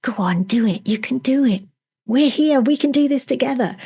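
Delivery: a hint of a tiny cheerleader voice; little tiny voice. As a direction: coax gently like a cheerful little helper. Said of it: "this little tiny voice saying".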